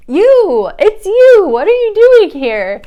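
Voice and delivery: loud, high-pitched excited shriek; excited scream. Retold or imitated. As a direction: scream it like a thrilled reunion. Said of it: "excited, like, kinda screams".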